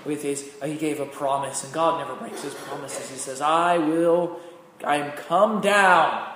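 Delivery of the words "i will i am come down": loud, high scripture proclamation; fervent preached reading; preaching at full force. Acted. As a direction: proclaim God's promise boldly. Proclaiming God's words.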